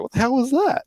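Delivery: high-pitched imitation of baffled onlookers; mock startled. Everybody reacting to Peach's odd outburst, mock startled. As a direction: jump up high in pretend disbelief. Voicing everybody's shock at the squeal.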